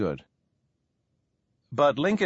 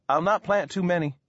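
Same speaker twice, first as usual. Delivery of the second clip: lightly voiced boy, higher pitch; boasting boy; 6 semitones higher than usual. A narrator's reading. Small boy answering his father's caution, lightly boasting. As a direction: assure Pappy with a touch of boyish boasting. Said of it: "the boy boasted".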